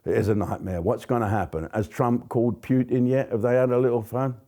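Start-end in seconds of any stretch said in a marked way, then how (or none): none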